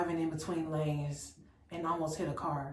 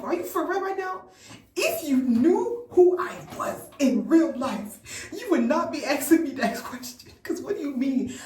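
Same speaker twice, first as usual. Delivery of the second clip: exasperated disbelief; 7 semitones higher, 7 dB louder than usual. Retold or imitated. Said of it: "I really wanted him to know".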